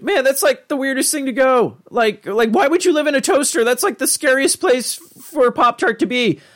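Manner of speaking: put-on high, baffled teen voice; Morty impression. Imitating Morty puzzling over the Pop-Tart toaster, high whine. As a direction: pitch it way up, baffled and whiny.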